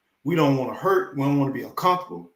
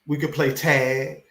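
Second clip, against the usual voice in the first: normal talking voice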